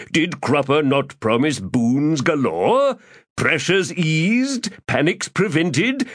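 florid, theatrical gentleman rogue; lofty, self-pleased orator; pompous Crupper voice. Acted.

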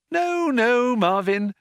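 very high sing-song reassurance; strained, over-bright comfort; lilting. A narrator's reading. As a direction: lilt it sweetly to the end. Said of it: "lilted Trillian".

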